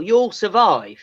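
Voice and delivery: ordinary talk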